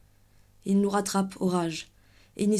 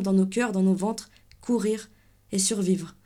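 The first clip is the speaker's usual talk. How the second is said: ordinary delivery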